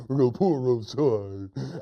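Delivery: goofy dim echo; silly hunter. Acted. Silly hunter copying the rope order, goofy voice. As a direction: parrot it like a dopey sidekick. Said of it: "He repeats everything his friend says".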